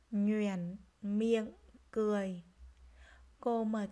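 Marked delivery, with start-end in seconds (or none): 0.1-2.4 "Nhoẻn miệng cười" word by word, full dipping tone on "Nhoẻn"; careful word reading. Shown as a demonstration. Teacher modelling "Nhoẻn miệng cười" for pupils, separated careful syllables. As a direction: sound out "Nhoẻn" fully, then "miệng", then "cười". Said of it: "nghe cô đọc lại các từ này".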